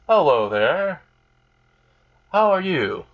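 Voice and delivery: high-pitched, lightly acted hello; acted greeting. Character greets a newcomer in story.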